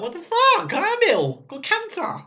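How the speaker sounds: patient protesting to his consultant; outraged disbelief. Retold or imitated. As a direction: blurt it out in shock. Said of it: "you're like".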